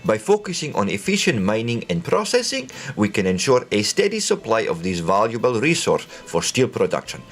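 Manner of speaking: put-on reading the announcement in a hammy Russian accent; a lightly done Soviet adviser with a Russian accent; bad Russian accent. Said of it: "do my worst Russian accent whenever these, uh, sort of announcement come out".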